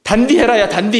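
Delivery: sharp Gyeongsang-style scolding of 단디해라; mock scolding in Gyeongsang dialect; scolding older woman. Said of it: "그 셰프들을 막 꾸짖어요".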